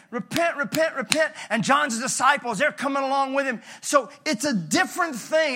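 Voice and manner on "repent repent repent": higher and louder, calling repent; mock prophet calling out to the crowd; prophet's cry. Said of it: "but he's saying".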